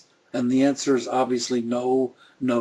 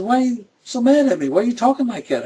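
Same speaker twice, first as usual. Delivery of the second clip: much higher, louder, fast hurt complaint; upset wife; 10 semitones higher, 4 dB louder, faster than usual. Retold or imitated. Wife Donna asking from another room why he sounds mad, high hurt protest. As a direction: play the wife calling out why he's mad. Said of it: "And she said".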